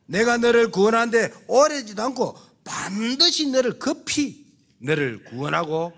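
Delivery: ordinary talk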